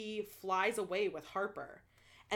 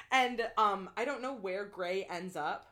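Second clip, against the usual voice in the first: ordinary talk, nothing marked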